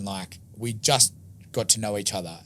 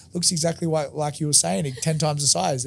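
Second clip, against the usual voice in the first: excited; 7 semitones higher, 5 dB louder than usual. Retold or imitated. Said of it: "Geordie goes".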